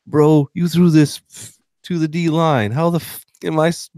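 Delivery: high, indignant athlete's quote; Antonio Brown. Acted. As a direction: act out an exasperated receiver's complaint. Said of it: "this was a quote from Mister Big Chest".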